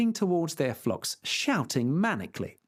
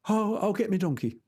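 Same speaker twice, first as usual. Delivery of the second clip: peasant voice; 6 semitones higher than usual. Acted. Sancho cheerful.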